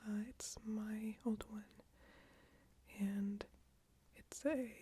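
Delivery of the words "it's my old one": usual speaking voice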